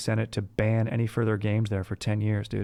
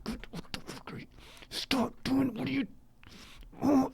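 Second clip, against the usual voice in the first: much softer, hissed whisper; dad's whisper; 13 semitones higher, 8 dB quieter than usual. Retold or imitated. Embarrassed dad hushing sons in front of tourists, whisper. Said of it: "my dad was like".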